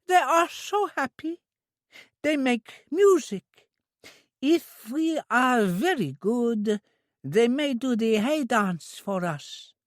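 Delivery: put-on high, slow simple girl's voice; an eager, innocent young woman; high, drawn-out childlike voice; childlike Trudy. A narrator's reading. Trudy coaxing Maggie.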